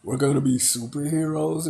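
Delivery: mock-gush it like a thrilled fan; lightly imitating a thrilled new recruit; ecstatic. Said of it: "Tucker's ecstatic about it. So is Cindy".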